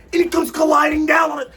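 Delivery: loud, high exasperated cry; exasperated shout. Real, not acted. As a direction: yell as the fort falls in. Fort collapses on him.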